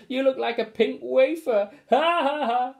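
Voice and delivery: high, loud mocking cackle; jeering joker. A narrator's reading. Joker jeers at the costumed family, high mocking laugh. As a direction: jeer at the pink wafer.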